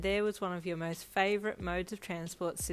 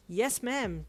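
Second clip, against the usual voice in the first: usual speaking voice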